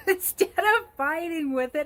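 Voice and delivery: laughing. Real, not acted. Speaker laughs recalling her advice to the client. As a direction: laugh through the words.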